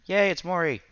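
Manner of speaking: ordinary delivery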